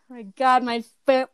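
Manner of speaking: words trembling after singing; shaking voice. Real, not acted. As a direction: voice shaking with nerves. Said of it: "voice is shaking so hard".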